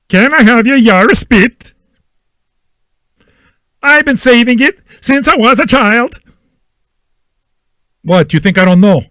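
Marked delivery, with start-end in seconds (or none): 0.1-6.2 high-pitched cartoon quote voice; cartoon character voice. Fan quoting memorized Ren and Stimpy lines, in a high cartoon voice. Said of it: "You're gonna watch Ren and Stimpy".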